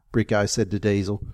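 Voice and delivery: plain reading voice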